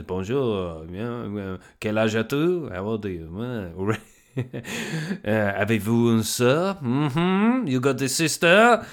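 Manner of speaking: mock nosy questioner in schoolroom French; nosy bastard. School French learner asking questions like a nosy bastard. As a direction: put on a prying French learner's voice. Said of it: "sounding like a nosy bastard".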